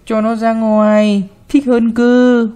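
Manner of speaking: put-on spoiled boy pressing his wish; child urging. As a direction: drag it out to nag grandma. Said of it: "được thể anh giục".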